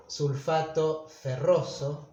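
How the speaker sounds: slow "sulfato ferroso", weight on "ro"; stress on ro. Shown as a demonstration. Teacher showing the stressed syllable of "ferroso", slow and stressed. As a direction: draw out "ferroso", hitting "ro". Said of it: "el acento cae en la sílaba ro".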